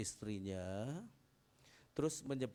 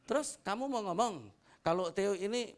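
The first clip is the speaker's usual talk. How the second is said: a louder, higher protest from the late wife; angry wife; 7 semitones higher, 6 dB louder than usual. Retold or imitated. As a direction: voice her indignant retort. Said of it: "mamanya marah begini". (istri protes dengan marah)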